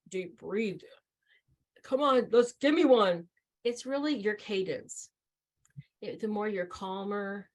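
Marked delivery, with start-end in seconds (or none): 0.1-3.3 brisk, louder urging to breathe; pushy coaching. Retold or imitated. Helper pressing an anxious person to breathe, loud urging. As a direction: push them to breathe, rising in volume. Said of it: "sometimes it's not you saying".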